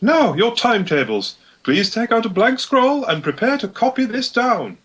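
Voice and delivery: high, prim strict teacher; stern schoolmistress addressing a pupil; Gisela voice. Acted. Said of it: "I'll take, uh, Gisela".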